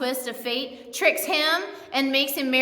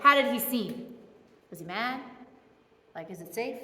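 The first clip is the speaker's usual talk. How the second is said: nervous hushed worry; 4 semitones lower, 6 dB quieter than usual. Retold or imitated. Nervous Jacob asks the messenger.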